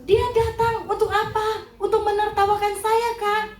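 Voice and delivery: high-pitched agitated retort; retold protest, voice pitched right up; upset, shocked. Wife protests to her sister about her husband's visit, upset and shocked. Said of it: "Perempuan tuh begitu kaget".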